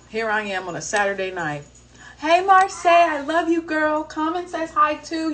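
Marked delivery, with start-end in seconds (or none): 2.2-5.3 high, louder greeting; excited shout-out. Real, not acted. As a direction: call out to her happily. Greeting a friend watching live.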